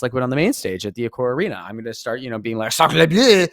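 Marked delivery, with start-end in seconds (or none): 2.7-3.5 loud, hammy mock French accent. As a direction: yell it in a cartoon French accent. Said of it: "learning some French terms to yell".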